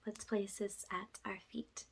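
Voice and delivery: ordinary talk, nothing marked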